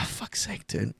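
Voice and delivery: sighing fuck's sake; exasperated sigh. Real, not acted. To trolling chat, sighing in exasperation. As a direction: breathe it out fed up.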